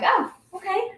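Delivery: high lightly surprised "Oh, okay"; a small surprised acceptance; mildly surprised. Retold or imitated. Reacting to neighbor's denial.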